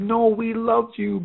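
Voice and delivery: high, drawn-out fan's voice; imitated gushing fan. Fan in a fabric store to a designer she recognized, imitated gushing. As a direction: gush it slowly, pitched well up. Retelling a fan's fond reaction.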